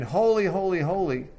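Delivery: "Holy, holy, holy" lifted high and loud, easing on the last; emphatic. Acted. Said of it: "is emphatic about the holiness of God".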